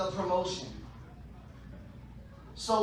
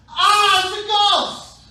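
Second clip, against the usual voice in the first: loud, high scream of alarm; scared cry; 12 semitones higher, 15 dB louder, slower than usual. Acted. Disciple in the boat spotting a figure on the sea, scared scream. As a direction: cry out in hammed-up terror. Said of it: "they were scared".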